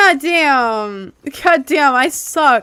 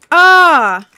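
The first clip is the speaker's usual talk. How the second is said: startled yelp; 5 semitones higher, 9 dB louder than usual. Real, not acted. Startled in game.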